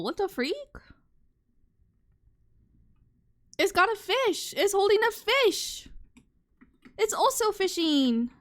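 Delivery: high, loud, thrilled gushing; wowed praising a drawing. Real, not acted. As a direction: gush over the cute cat drawing. Excited at seeing a cat drawing.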